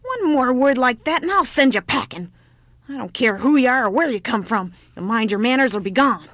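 put-on loud old woman's threat; angry scolding. Old woman angrily threatens child.

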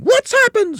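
very high, loud, alarmed shriek; screamed. A narrator's reading. Mrs Twit sees her upturned room, screaming. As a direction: scream it in shock. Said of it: "screamed Missus Twit".

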